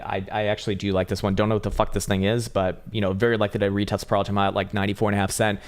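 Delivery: normal talking voice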